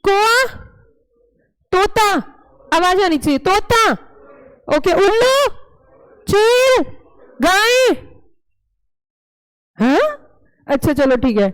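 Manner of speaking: quick game calls. Acted. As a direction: call each bird out sharply to the kids. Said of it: "अब मैं जल्दी जल्दी बोलूंगी". (बच्चों के खेल में ऊँची, ज़ोरदार पुकार)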